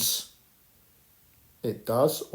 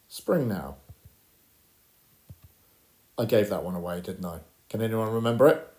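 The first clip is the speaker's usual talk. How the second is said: ordinary talk, nothing marked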